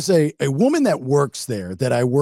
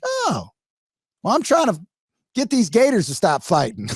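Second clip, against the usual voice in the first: cheery Savannah; 5 semitones higher than usual. Retold or imitated. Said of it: "She's like".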